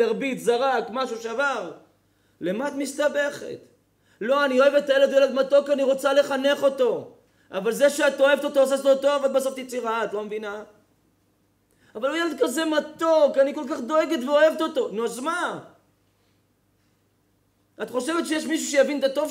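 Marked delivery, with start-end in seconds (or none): 4.2-7.1 higher, louder pleading assistant; earnest kindergarten assistant, lightly voiced; voicing the assistant. 11.9-14.9 higher, louder pleading assistant; earnest kindergarten assistant, lightly voiced; voicing the assistant.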